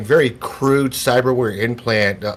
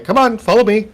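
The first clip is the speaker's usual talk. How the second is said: put-on high, loud kid's beckoning; calling out; 12 semitones higher, 5 dB louder than usual. Kid calling the group after him, loud. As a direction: call them along in a raised kid voice.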